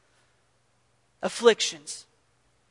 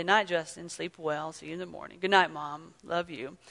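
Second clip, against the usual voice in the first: soft goodnight; 6 semitones lower, 11 dB quieter than usual. Retold or imitated. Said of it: "she'd say".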